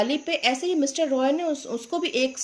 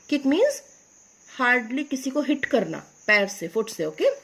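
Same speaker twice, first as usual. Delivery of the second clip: ordinary talk, nothing marked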